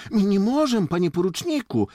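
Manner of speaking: high, loud pleading excuse; defensive protest. A narrator's reading. Jakub begs off guiding the lieutenant, protesting. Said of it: "zaczął się bronić Jakub".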